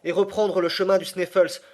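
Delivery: high and loud, pressing on; cry out. Acted. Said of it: "m'écriai-je".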